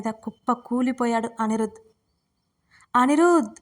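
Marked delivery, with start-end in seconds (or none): 3.0-3.5 a louder, higher scream of his name. A narrator's reading. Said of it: "స్వర గావు కేక పెట్టింది". (గావు కేక)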